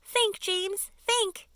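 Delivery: high, loud pleading cry; a spider begging James for an idea. Acted. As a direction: beg him urgently. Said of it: "begged Miss Spider".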